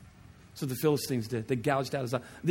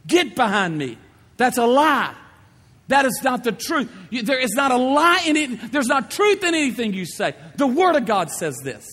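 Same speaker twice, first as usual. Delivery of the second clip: loud, high, forceful rebuke; rebuking the devil; 9 semitones higher, 8 dB louder than usual. Acted. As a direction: cast the tempter away with full force. Preacher rebukes the tempting enemy.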